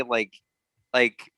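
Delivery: ordinary talk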